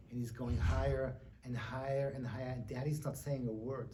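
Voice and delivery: low, hushed, foreboding narration; suspenseful storytelling. Acted. Telling of a child's scary drive, hushed and ominous. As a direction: build dread softly, held low.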